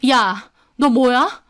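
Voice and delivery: slow, confrontational challenge; angry. A narrator's reading. Woman turning on her rival, angry. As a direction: square up to her, drawing it out. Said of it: "저는 화가 나서 이번엔 이슬비에게 다가갔습니다".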